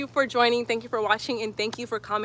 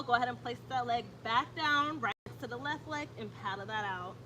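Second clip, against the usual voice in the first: calm, even yoga class cues; steady instructor voice pacing the breath; yoga instructor cueing; 12 dB quieter than usual. Acted. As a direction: guide the class through each breath. Leads a yoga flow.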